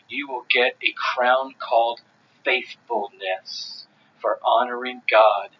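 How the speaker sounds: normal talking voice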